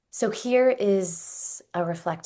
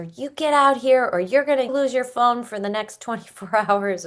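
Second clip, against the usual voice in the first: put-on loud, higher threatening yell at the child; yelling parent; 6 semitones higher, 6 dB louder than usual. Parent yelling threats at a sulking teen, loud. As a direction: bark the ultimatum up the stairs.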